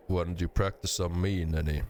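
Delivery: usual speaking voice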